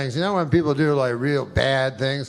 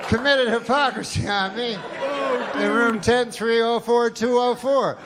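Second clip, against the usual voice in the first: raised, higher mock cry for help down a hotel hall; hammed-up yell for help, pitched up; calling for help; 9 semitones higher than usual. Girl mockingly yelling for help about the hotel room, high and raised. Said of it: "I'll call for help".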